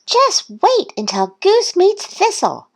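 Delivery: high-pitched eager duck voice; Duck's eager thought. A narrator's reading. Duck racing his new friend, eager high voice. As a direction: think it eagerly, high and lively. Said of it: "Duck thought as he raced".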